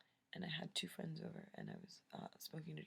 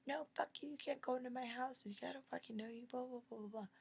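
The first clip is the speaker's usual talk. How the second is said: high-pitched ranting refusal; retold angry yelling; 10 semitones higher, 4 dB louder than usual. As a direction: yell him off, trailing into mock blah-blah. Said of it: "but I yelled at him a lot".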